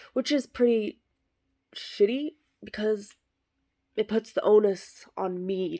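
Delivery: indignant outburst; loud complaint. Acted. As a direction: spit out the complaint.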